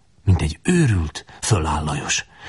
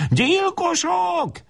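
very high, long-drawn scream-like cry; shriek; much slower than usual. A narrator's reading. Pinned woman crying out at the boys, shriek. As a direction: shriek it, stretched out at soaring pitch. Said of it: "és visítja".

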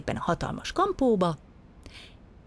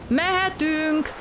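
high, drawn-out order to set off; captain's called order; 11 semitones higher, much slower than usual. A narrator's reading. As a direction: call the order out slowly, high-pitched. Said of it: "kiadta a parancsot".

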